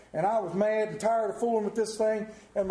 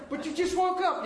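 teasing; 9 semitones higher than usual. Pretend. Acted friend teasing, high voice.

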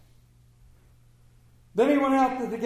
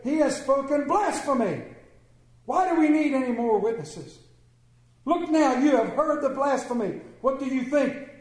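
higher, louder outraged charge; indignant accusation; 6 semitones higher, 3 dB louder than usual. Acted. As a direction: denounce him as a blasphemer. High priest tears clothes, accuses.

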